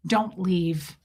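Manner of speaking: ordinary talk, nothing marked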